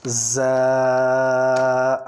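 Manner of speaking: زا buzzed hard and firm. Shown as a demonstration. Teacher models a firm زا for the class. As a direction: press زا out firmly. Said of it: "یہ زا سختی سے پڑھا جائے گا".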